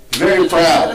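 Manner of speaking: normal talking voice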